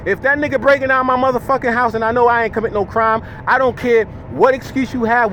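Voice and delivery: normal talking voice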